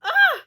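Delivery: short, high, loud cry; startled yelp. Real, not acted. Viewer startled by the plot, yelping. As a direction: yelp suddenly in shock.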